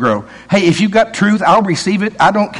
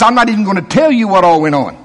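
ordinary delivery

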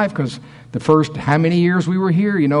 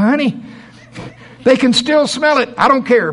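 high pleading voice; 10 semitones higher, 4 dB louder than usual. Retold or imitated. Retold pleading with wife.

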